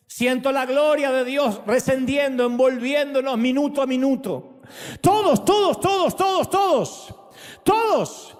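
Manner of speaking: high, soaring repeated todos; rising ecstatic call. Acted. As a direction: lift the room to a peak. Climax of prayer.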